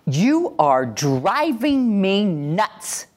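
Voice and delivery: slow, drawn-out exasperated parent; fed-up parent dragging the words out slowly. Shown as a demonstration. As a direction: let the frustration drag out slowly. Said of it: "Instead of saying this".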